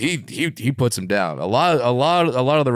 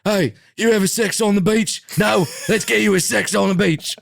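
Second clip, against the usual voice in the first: Will Ospreay impression; 11 semitones higher than usual. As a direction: do a boozy British wrestler offering drinks. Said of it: "That's a pretty good Will Ospreay".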